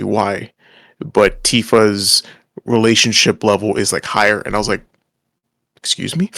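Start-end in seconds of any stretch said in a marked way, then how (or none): 5.8-6.3 surprised. Retold or imitated. Shocked reaction to game.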